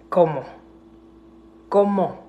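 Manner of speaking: fed-up, incredulous demand; exasperated demand. Real, not acted. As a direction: throw the question back at them in disbelief. Demanding how they'll fix it.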